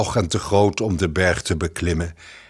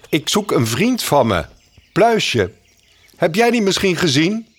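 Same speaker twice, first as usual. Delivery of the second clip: lightly voiced chirpy mockingbird, higher; a bird asking around for his friend; a hint of a perky little bird; Gniffel the mockingbird; 8 semitones higher than usual.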